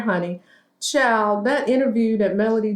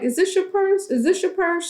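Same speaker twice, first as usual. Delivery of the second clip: eager high voice; 9 semitones higher than usual. Retold or imitated. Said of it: "He was like".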